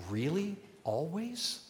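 a rising, doubtful "Always?" questioning himself; mock doubt. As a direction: poke holes in your own claim, softly. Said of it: "questions his own claim".

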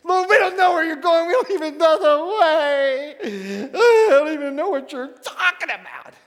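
high, loud, near-crying exasperation; a frantic disciple at his wits' end; exasperated Thomas. Shown as a demonstration. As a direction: pitch it up into a whiny, bewildered wail. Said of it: "I think he said it like this".

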